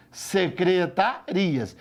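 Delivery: "Secretarias" very slow, in separated syllables. Shown as a demonstration. Teacher shows what is written, "Secretarias" syllable by syllable.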